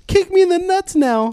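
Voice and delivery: put-on high squeaky little kid voice; Mickey Mouse kid voice. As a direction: squeak it out like a tiny cartoon kid. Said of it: "That sounded like Mickey Mouse".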